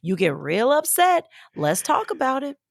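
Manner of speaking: high, sweet coaxing auntie. Retold or imitated. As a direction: sweet-talk her like a caring auntie. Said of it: "auntie voice".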